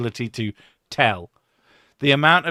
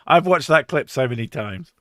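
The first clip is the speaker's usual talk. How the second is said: ordinary talk, nothing marked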